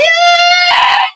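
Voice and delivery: very high, loud, long cheer; cheering shriek. Acted. Sloan celebrating the engagement, high cheering shriek.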